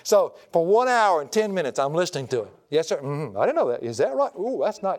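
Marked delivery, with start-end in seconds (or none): 2.7-5.0 mock polite. Preacher humoring nonstop talker.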